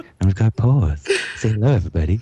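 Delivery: creepy radio announcer. Acted. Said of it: "that was my slightly creepy, uh, radio announcer voice".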